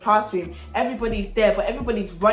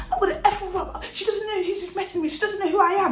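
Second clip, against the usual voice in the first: high, frantic acted threats; enraged ranting; 10 semitones higher, faster than usual. Classmate charging back at Rachel, raging. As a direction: rant in a much higher pitch. Acts out furious attacker.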